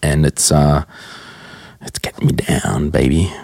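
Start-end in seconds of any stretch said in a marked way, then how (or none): none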